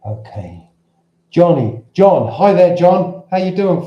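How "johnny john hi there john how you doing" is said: excited greeting. Real, not acted. Greeting a viewer joining the stream.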